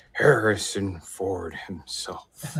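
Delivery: slow unvoiced whisper. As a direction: breathe it out slowly. Whispered reveal, laugh follows.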